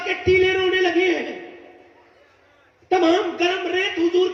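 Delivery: high, full-force sermon cry; impassioned preaching. Acted. Preacher tells the congregation the dunes wept, impassioned.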